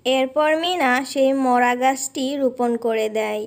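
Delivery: even storyteller's narration voice; story narration. A narrator's reading. Narrator tells children how Meena sets off to play, in storyteller voice. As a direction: narrate the scene calmly for children. Narrator between cartoon dialogue.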